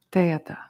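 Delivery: "teata" as tay-ah-ta, no d; Tibetan pronunciation. Shown as a demonstration. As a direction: model "teata" with a tay opening. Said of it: "it's gonna sound like".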